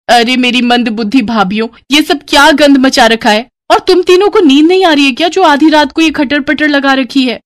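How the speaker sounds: high, loud, fast telling-off; scolding sister-in-law. Acted. Sister-in-law scolds brides for dawn noise, high and sharp.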